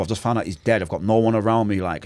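normal talking voice

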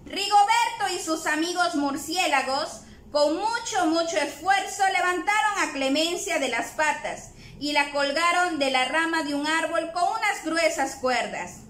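ordinary delivery, nothing marked

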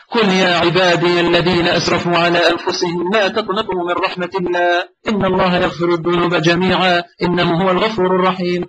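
fast recited Quranic chant; Quran recitation. Acted. Shaykh recites the ayah to the grieving young man, chanted. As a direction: chant the verse in recitation style.

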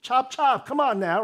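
impatient mock hurry-up. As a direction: hurry her along like a pushy boss. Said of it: "That's what Elijah is basically saying".

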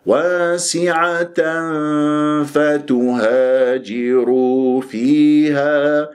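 very slow chant, long hum between واسعة and فتهاجر; full free ghunna. Shown as a demonstration. Teacher models واسعة فتهاجر فيها, unchoked sustained recitation. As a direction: recite with every long vowel and nasal held. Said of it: "لا أخنق الغنة".

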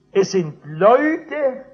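very slow, loud, drawn-out emphasis; solemn preaching. Acted. Preacher slowly and loudly stressing the name of Jesus. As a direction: lean on every word.

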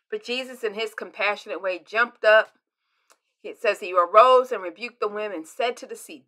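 usual speaking voice

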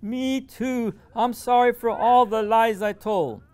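put-on a boy crying his sorry, high and loud; sobbing heavily. A narrator's reading. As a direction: sob the apology out. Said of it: "Lupe begins to sob heavily".

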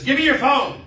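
loud, high-pitched imitated demand; frantic shout. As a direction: imitate someone yelling in a panic. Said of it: "she's now frantic".

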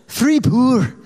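high insistent Scottish woman; Scottish lilt, very high, stubborn; imitating Ruth's Scottish insisting; Scottish accent. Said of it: "that's my Ruth Connell".

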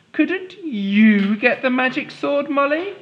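high pleading king voice; high wheedling king. Acted. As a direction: coax the girl sweetly. King asks Molly.

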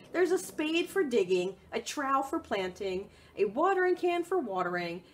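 lively picture-book read; reading to children. Acted. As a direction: read it out brightly for little ones.

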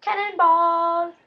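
slow drawn-out Cannonball call; cannonball yell. Acted. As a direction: announce it with a stretched shout. Calls the trick name.

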